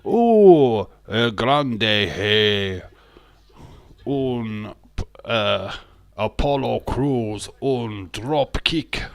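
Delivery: over-the-top fake Spanish play-by-play; mock Spanish commentator. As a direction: ham up a Spanish wrestling announcer. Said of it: "where I were in Espanol".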